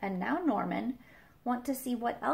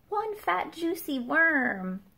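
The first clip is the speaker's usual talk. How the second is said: slow, high, louder, savouring each word; relishing; 7 semitones higher, 7 dB louder, slower than usual. Acted. Reading to kids about the worm, with relish. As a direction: dwell on every juicy word.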